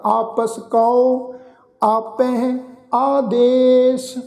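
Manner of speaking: slow, drawn-out scripture chant; slow devotional recitation of the verse. Shown as a demonstration. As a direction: recite each word slowly and reverently.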